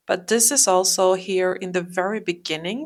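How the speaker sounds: usual speaking voice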